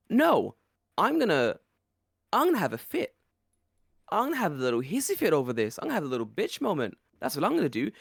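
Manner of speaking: high whiny sulking voice; petulant child. Retold or imitated. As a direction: pitch it up like a sulky kid. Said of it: "don't act like a petulant child".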